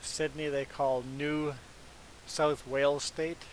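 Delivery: ordinary talk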